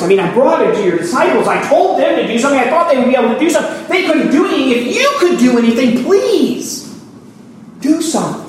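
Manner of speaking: beg, voice climbing; desperate plea. Retold or imitated. Father pleading with Jesus to do something, desperate.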